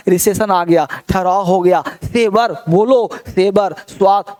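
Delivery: ordinary delivery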